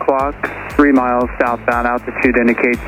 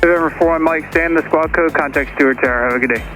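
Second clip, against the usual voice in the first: air traffic control radio; 5 semitones higher, faster than usual. Real, not acted. Controller hands off over radio.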